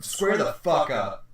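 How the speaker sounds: slow tough challenge. Pretend. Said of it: "I would be like".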